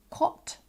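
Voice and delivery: "cot" with a short o; short rounded vowel in "cot"; different accent. Shown as a demonstration. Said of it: "in a different accent".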